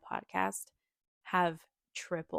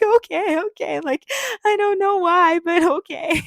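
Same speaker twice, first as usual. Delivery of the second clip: much higher, louder, teary giving in; crying in bed; 11 semitones higher, 11 dB louder than usual. Retold or imitated. Woman tells husband through tears in bed, teary high cry. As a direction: choke out a tearful surrender. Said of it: "I'm just crying in bed".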